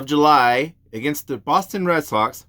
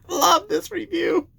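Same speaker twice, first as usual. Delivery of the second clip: laughing; 14 semitones higher than usual. Real, not acted. Laughing at a review.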